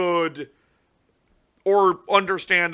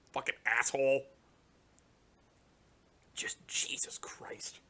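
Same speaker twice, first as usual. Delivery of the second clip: exasperated whisper; 5 semitones lower, 12 dB quieter than usual. Real, not acted. Cursing to himself at an unwanted card, whispered. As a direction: breathe it out in disbelief.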